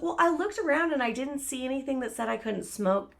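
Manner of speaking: high, prissy mock voice; roommate's excuse, mocked high.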